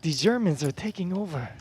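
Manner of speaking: a clumsy mock German accent, played for a laugh; joking about the Germans in a hammy German accent; bad German accent. Commentator teasing his co-host in a deliberately bad German accent. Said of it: "That was the worst accent I probably could have done".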